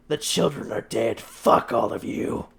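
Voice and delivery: snarling captive. Acted.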